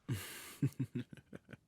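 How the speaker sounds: a short, rising, satisfied laugh; sly laugh. Acted. Emperor laughing once the servant is gone, sly. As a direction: chuckle at the pet's embarrassment.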